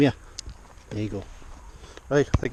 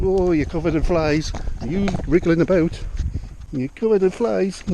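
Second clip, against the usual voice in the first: fussing pet talk; 5 semitones higher, 4 dB louder than usual. Real, not acted. Fussing over fly-covered horse.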